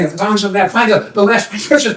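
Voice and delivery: ordinary delivery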